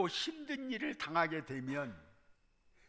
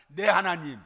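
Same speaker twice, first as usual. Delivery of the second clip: 내 하나님 said louder, as a group response; called out with the congregation; 9 dB louder than usual. Shown as a demonstration. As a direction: lead the crowd: 내 하나님, loud. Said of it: "다 같이 한번 합시다". (회중과 함께 크게 외치는 내 하나님)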